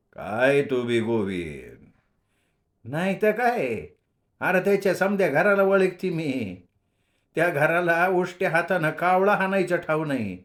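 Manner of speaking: lightly voiced old woman scoffing; old woman snapping. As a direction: scoff like a sharp-tongued grandmother. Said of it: "म्हातारी फणकारली मध्येच".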